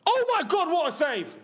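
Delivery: very high burst, falling away; amazed outburst. Real, not acted. Viewer reacting live to a great save, amazed outburst. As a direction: react to a stunning moment, pitch shooting up.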